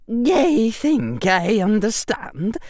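slow, high Scottish professor; said slowly. A narrator's reading. Professor taking in the explanation, speaking slowly. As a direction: take it slowly, with Scottish precision. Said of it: "Professor McGonagall said slowly".

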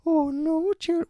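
put-on very high falsetto old nanny; Mrs Doubtfire voice. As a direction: pipe it up like a fussy nanny. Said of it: "now it's Missus Doubtfire".

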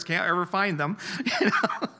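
laughing through an embarrassed admission. Real, not acted. Guest laughs at himself about needing glasses. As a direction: chuckle all the way through.

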